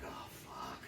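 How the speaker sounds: breathless whispered dread; whispered curse. Retold or imitated. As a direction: hiss it under your breath. Whispered dismay at trailer.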